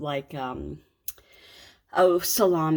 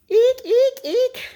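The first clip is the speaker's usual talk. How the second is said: squeal; 9 dB louder, slower than usual. Pretend. Mock squeal at the freezer mess.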